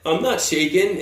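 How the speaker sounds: imitating a man's shaky denial; mock wobble in the voice; shaking voice. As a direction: act out someone trembling while denying it. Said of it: "his voice was, like, shaking".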